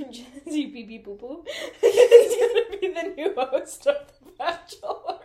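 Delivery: giggling through the news; can't keep a straight face; laughing. Real, not acted.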